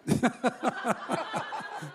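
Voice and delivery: real high, soft chuckling fit; cracking up. Real, not acted. Speaker losing his place in a blonde joke, cracking up. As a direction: laugh helplessly, losing the thread. Loses the thread telling joke.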